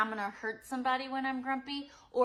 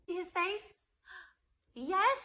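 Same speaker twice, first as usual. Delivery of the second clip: surprised; 10 semitones higher, 6 dB louder than usual. Shown as a demonstration. Showing surprised face card.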